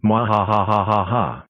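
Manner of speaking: slow drawn-out villain cackle; slow evil laugh. Acted. Villain in hiding.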